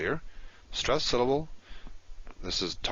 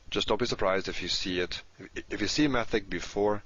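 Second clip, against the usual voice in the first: usual speaking voice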